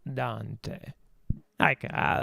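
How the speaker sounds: ordinary talk, nothing marked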